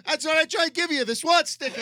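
high, very loud mock New York cop; New York accent. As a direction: insist loudly in hammed-up New York. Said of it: "like, a New York accent".

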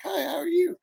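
high, bright phony hello; mock an overly sweet greeting; fake friendly greeting. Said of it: "I don't even talk like that".

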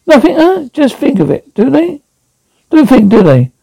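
furious machine, pitched high; angry high robot. Acted. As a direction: rant at the humans. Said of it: "it roared".

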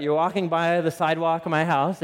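normal talking voice

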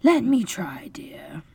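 calm a fuming husband gently; placid. A narrator's reading. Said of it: "Missus Darling was placid".

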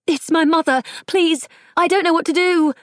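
put-on high, pleading young woman calling out; desperate cry for help. A narrator's reading. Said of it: "cried out".

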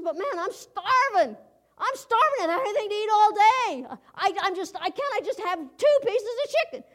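high, whiny begging governor; pleading governor. Retold or imitated. Hungry governor begs the lunch lady, high whiny plea.

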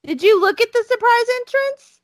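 surprised question. Real, not acted. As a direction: she can't believe he picked a surprise.

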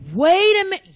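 high, loud startled wake-up; sudden realization. Retold or imitated. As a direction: jump up in surprise as it dawns.

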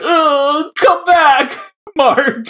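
high, whining plea with a sob; whiny Steven voice. Retold or imitated. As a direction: cry out for him in a high, pleading wail. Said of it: "He's like".

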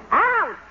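sharp high yelp of pain at a pinch; mock ouch. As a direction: squeal as if pinched. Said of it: "Maybe if I pinch myself".

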